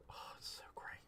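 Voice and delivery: soft awed whisper. Real, not acted. Crafter gushing over the foiled frames, whispered. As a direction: breathe it out in a whisper. Admiring foiling, hushed aside.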